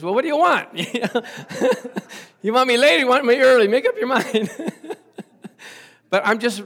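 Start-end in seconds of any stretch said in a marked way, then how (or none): 0.0-0.7 much higher, louder mock-exasperated retort. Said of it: "she goes". 2.4-4.5 high, loud, fast laughing complaint; laughing mock protest. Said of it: "she goes".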